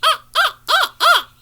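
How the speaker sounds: high, loud cawing "Ah, ah"; crow caw. Shown as a demonstration. Bird caller demonstrates the southern crow, loud high caws. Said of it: "and the southern crow goes".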